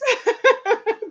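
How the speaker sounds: high, bubbling laugh; real laugh. Real, not acted. Amused at herself before getting started, real laugh.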